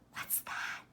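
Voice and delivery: soft unvoiced whisper. A narrator's reading. Little girl whispering to her dad, frightened. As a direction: breathe the question with no voice. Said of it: "she whispered to her dad".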